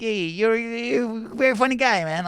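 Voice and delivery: put-on high, slow Italian accent; stretched-out, high-pitched Italian gushing; Italian fan. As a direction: imitate an admiring Italian stranger. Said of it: "Oh yeah, the Italian".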